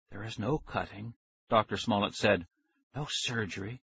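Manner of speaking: very soft, calm reassurance; reassuring hush. A narrator's reading. Scientist reassures frightened Max, very soft. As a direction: soothe him quietly.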